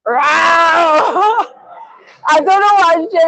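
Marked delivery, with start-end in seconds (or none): 0.0-1.4 long wailing "row"; imitated cry. Old Spanish lady going on at streamer, imitated long cry. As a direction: stretch out her odd exclamation. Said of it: "she's, she's going".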